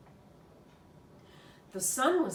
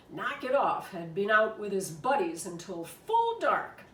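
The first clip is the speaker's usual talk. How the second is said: ordinary delivery, nothing marked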